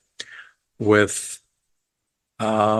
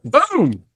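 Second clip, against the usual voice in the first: a very high, odd-voiced boom; mock gunshot; 14 semitones higher than usual. Reviewer retelling the second shot, mock gunshot. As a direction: pop out a second gunshot sound.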